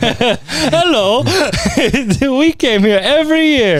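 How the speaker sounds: very high, laughing mock Polish accent; a giggly put-on Polish accent. Imitating a Polish ghost family knocking, in a giggly mock Polish accent. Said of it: "what Polish accent that was".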